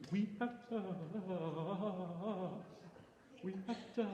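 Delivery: soft, very light touch. Shown as a demonstration. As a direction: sing it very lightly. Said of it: "Very light".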